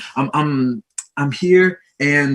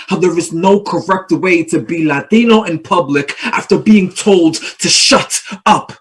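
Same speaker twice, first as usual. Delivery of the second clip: spoken word poem; 3 semitones higher than usual. Acted. Poet performs for a festival audience, building to defiant force. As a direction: drop back, then build to an impassioned declaration.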